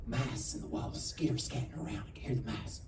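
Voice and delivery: soft, whispered skitter scatter; hushed mouse story. Retold or imitated. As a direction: whisper it like listening to the walls. Mom hearing mice in walls.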